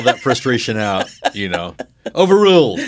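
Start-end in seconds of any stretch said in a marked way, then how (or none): 2.1-2.8 loud, high judge's ruling. Pretend. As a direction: bang the gavel and rule. Mock courtroom ruling.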